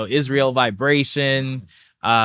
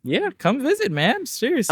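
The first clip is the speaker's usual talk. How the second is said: much higher, bright insistence; eager invite; 13 semitones higher than usual. Real, not acted. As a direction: push the invite with a grin.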